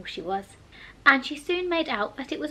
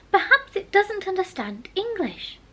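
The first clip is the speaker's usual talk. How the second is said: Alice's voice; 9 semitones higher, 3 dB louder than usual. Acted.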